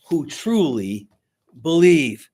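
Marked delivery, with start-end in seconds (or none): none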